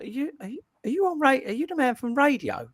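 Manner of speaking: imitating a stranger. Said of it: "didn't say geezer".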